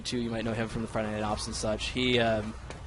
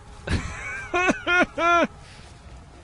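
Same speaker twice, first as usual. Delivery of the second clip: high laugh. Real, not acted. Laughing at a game moment.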